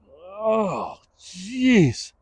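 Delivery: high, breathless dismay; stricken with fear. Retold or imitated. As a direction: let the fear catch you mid-word. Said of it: "stricken with fear".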